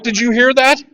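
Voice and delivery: high, loud urgent question; excited question. Retold or imitated. Husband waking wife over the verse, excited. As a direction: grab her attention, amazed. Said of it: "I'm like".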